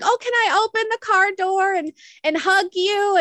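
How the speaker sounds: high, sweet grandma voice, pitched well up; grandma gushing. Retold or imitated. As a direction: gush like a doting grandmother. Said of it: "she's like".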